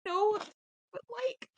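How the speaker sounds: high, breaking, whispered plea; tearful whisper. Acted. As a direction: whisper it through tears. Pleading with father, tearful.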